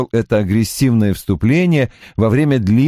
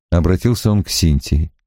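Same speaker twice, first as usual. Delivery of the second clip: plain reading voice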